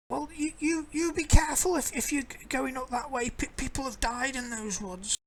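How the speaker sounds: put-on high old woman warning; a worried crone cautioning strangers; old lady voice.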